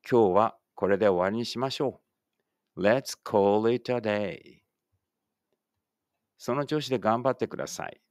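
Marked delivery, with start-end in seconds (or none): 2.8-4.6 slow, carefully spaced English for the class to repeat; slow model reading. Shown as a demonstration. Teacher models English for pupils reading aloud together, slow and clear. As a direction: say each word slowly and clearly, like a teacher modeling. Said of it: "一緒に声出してください".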